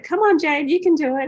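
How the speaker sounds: rally her like a coach; encouraging cheer. Retold or imitated. Healer cheering a hesitant client to yell, loud.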